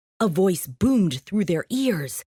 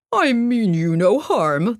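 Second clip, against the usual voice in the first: fearful lion. Acted. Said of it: "the lion stuttered in fear".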